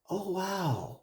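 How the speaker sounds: soft, high "oh wow" of discovery; hushed, lifted wonder, acting out the moment; imagined quiet amazement. Imagining coming home to a clean house, quietly amazed.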